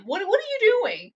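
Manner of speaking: ordinary talk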